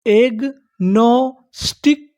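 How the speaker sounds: Agnostic stretched very slowly as egg-no-stick; syllable by syllable. Shown as a demonstration. Teacher building the full word for learners, slow syllable by syllable. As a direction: draw out each part of agnostic, rising at the end. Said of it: "हमारी पूरी meaning क्या बनी".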